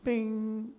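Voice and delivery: a high, ringing sung "Ping" like a steel tuning fork. Retold or imitated. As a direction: chime the note like the fork. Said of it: "A steel looking thing go".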